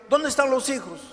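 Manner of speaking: high, loud demand; angry demand. Acted. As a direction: play the violent father demanding an answer. Drunk father demands children. (exigencia furiosa, fuerte y aguda)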